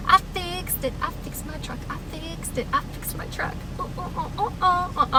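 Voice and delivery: high-pitched, breathless celebration; celebrate, overjoyed at your own repair; excited. Real, not acted. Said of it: "I'm so excited".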